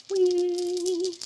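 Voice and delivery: high playful whee; excited whee. Real, not acted. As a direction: let out a gleeful whee. Excited roll.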